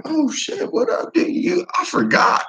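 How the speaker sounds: very high, airy, laughing; high mock-embarrassed chatter; squeaky embarrassed excuse. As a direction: cover for forgetting the plan. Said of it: "I'm like".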